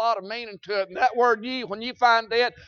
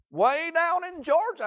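imitating questioners; 8 semitones higher than usual. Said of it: "they say".

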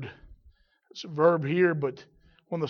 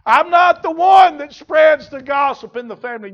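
shouted, higher mock churchgoer's denial; raised-voice self-defence; indignant protest; 9 semitones higher, 15 dB louder than usual. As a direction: loudly deny it, playing the churchgoer.

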